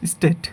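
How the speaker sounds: স্টেট with a short pure e; common mispronunciation. Shown as a demonstration. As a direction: say স্টেট as "stet", no glide. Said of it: "এটাকে আমরা বলি".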